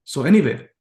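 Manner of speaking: ordinary talk, nothing marked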